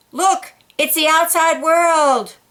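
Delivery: plain reading voice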